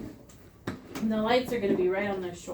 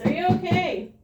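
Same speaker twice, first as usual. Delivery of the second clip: higher, raised-voice check for a response; loud check; 8 semitones higher, 4 dB louder than usual. Shown as a demonstration. First aid teacher checks a collapsed person for response, loud. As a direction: call out to rouse someone unresponsive. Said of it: "we're gonna be loud".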